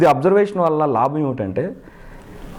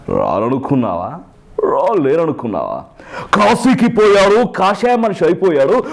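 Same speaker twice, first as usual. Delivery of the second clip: Chiranjeevi film hero; 4 semitones higher than usual. Shown as a demonstration. Mimic demonstrating an older Chiranjeevi dialogue, ringing dramatic delivery. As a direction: declaim it like a mass-film hero. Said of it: "ఇప్పుడు అది లేదు".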